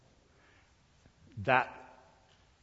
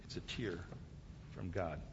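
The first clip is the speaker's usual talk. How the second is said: near-whisper, much lower and softer; hushed reverence; 5 semitones lower, 11 dB quieter than usual. Acted. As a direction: let the last words sink into a reverent hush. Hushed close of God's answer.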